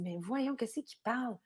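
soft, puzzled aside; Raymond. Retold or imitated. Partner puzzled by the French guy, hushed aside. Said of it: "Là, Raymond, il dit".

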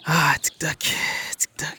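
murmure dédaigneux; chuchoté. Real, not acted. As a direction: souffle le mot TikTok. Chuchote TikTok avec dédain. (chuchotement méprisant)

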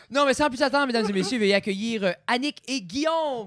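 high, loud, announcer-style guest introduction; grand entrance announcement. Acted. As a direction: roll out the guests like a game show host.

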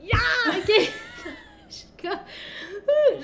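high loud mock hype; hyped cheering. Real, not acted. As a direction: cheer it out, then laugh. Mock enthusiasm about turning twenty-five.

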